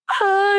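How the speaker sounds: high burst of imitated crying; mock crying wail. New mom greeted at work bursts out, mock crying wail. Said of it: "I started crying".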